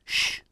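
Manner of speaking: a short sharp "shh"; shushing. Acted. Bramwell hushes Little Bear, shushing. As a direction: hush someone mid-performance.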